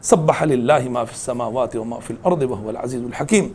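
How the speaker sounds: Quranic recitation. Acted. Preacher reciting a verse to the congregation, low and chanted.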